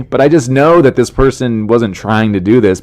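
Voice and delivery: ordinary delivery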